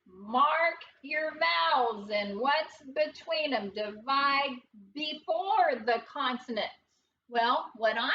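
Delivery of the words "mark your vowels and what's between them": usual speaking voice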